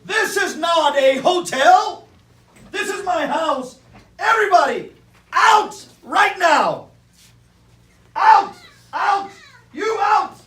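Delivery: hammed-up furious bear shouting. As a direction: throw everyone out in a rage. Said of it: "Bruce lost his temper".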